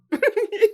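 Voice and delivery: high bright laugh bursting out; sudden real laugh; burst of laughter. Real, not acted. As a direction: laugh at the memory. Laughing at own story.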